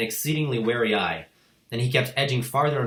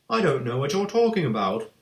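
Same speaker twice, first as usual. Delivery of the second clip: puzzled; 5 semitones higher, 4 dB louder than usual. A narrator's reading. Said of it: "more puzzled than ever".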